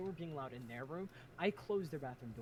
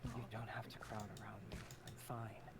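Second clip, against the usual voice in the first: tired whisper; 4 semitones lower, 5 dB quieter than usual. Acted. Tired character, unvoiced.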